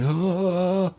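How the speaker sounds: soft high groan of relief. Retold or imitated. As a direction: let out a weak, high moan. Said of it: "and was like".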